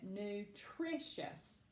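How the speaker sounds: slow, measured "Nutritious", drawn out; careful word reading. Shown as a demonstration. Teacher reading a coded word to the class, careful word reading. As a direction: read "Nutritious" slowly and softly, stressing the second syllable. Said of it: "I'm gonna read it".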